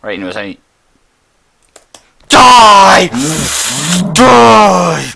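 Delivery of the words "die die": loud yell while attacking; battle yell. Real, not acted. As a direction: scream it as you strike. Attacking a mob.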